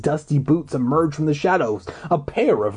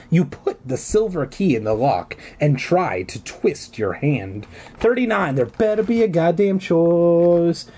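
ordinary delivery

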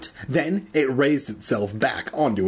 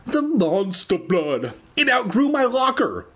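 crying out; 10 semitones higher than usual. A narrator's reading. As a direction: shriek the news in mock horror. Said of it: "Andy cried".

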